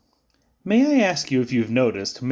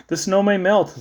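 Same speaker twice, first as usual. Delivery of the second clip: usual speaking voice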